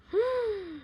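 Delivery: high sharp gasp of alarm; a quick startled intake of breath. Acted. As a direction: gasp as if something bad is coming. Bull about to sit on bee.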